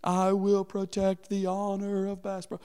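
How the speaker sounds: a hammed-up vow to defend the store's honor; mock heroic vow. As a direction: proclaim it like a movie hero, tongue in cheek. Heroic vow, retold self.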